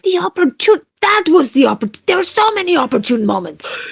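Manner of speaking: high-pitched, incredulous outburst; exasperated. Acted. Silver Tongue rebukes Pedro for stalling, exasperated. Argues uncle should have told her.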